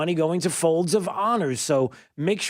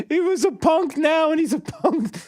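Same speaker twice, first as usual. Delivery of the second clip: laughing repeat of the caller; 12 semitones higher than usual. Retold or imitated. Host laughingly repeats caller's line.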